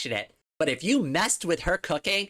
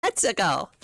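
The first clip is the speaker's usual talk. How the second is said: Mario impression; 3 semitones higher than usual. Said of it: "That's my best Mario impression".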